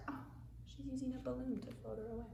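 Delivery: fast, hushed aside; whispered excited aside; quick whispered aside. Real, not acted.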